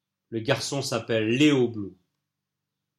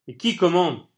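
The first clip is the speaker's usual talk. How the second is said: higher, louder urgent question; urgent demand; 6 semitones higher, 5 dB louder than usual. Acted. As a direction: press hard for the answer. Toby presses Colin urgently.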